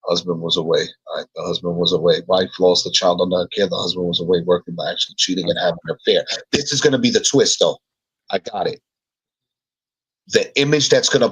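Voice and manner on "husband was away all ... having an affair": low, rapid run-through of notes; fast and low, skimming what was written; quick read-back. Real, not acted. Reading back the notes just written.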